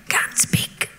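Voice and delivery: a breathless whisper, no voice at all; voiceless whisper. Retold or imitated. Storyteller telling her at the airport he's lost his voice, whisper. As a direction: push the words out on air only. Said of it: "in that voice".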